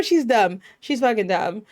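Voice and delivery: ordinary delivery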